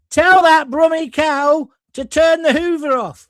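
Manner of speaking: loud, slow, furious bellow at the cleaner; angry director shout. Retold or imitated. Director yelling over the buzzer, loud slow furious shout. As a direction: roar the order down from the gallery. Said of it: "He goes".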